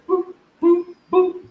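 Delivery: high beeping noise; high mock machine beeps; scanner beeps. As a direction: beep like a body scanner.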